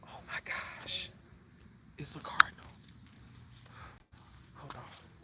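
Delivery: real awed whisper, barely voiced; hushed awe. Real, not acted. Vlogger spots a cardinal while live, hushed whisper. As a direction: whisper it so the bird stays put.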